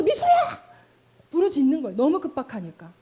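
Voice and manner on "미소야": high, loud urgent call of a name; mother crying out to her child at the platform edge. Retold or imitated. 다급하게 아이 이름을 외침. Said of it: "너무 급박하니까 부르짖는 거".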